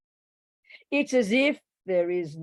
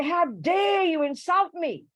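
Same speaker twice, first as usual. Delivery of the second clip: high, slow, hammed-up indignation; mock outrage at a nose insult; 11 semitones higher, 4 dB louder, slower than usual. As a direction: play the offended self, drawn out. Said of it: "I'll say".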